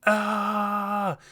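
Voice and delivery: high, loud retold scream; imitating a raging scream; mock scream. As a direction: belt out a short scream. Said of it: "he just started screaming".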